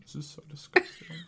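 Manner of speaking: whispered, revolted; disgusted whisper. Real, not acted. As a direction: whisper it through nausea. Still chewing gross food.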